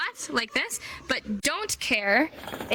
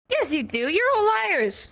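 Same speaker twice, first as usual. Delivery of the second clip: mock accusation; 9 semitones higher than usual. Teacher mock-accusing students.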